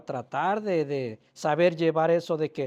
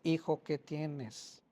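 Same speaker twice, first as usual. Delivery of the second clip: put-on slow and softer, coaxing the boy; gentle, slow asking; 9 dB quieter, much slower than usual. Father asking his crying son a third time, slow and soft. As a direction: ease it out slowly and softly, like coaxing a hurt child.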